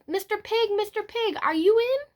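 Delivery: calling out. Retold or imitated. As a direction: call up sweetly to the house. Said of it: "I called".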